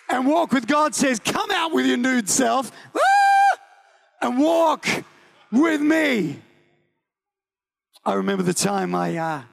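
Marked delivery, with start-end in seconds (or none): 1.2-6.4 shouted call. Acted. Preacher voicing God's call to the crowd, shouting. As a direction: call out with full force.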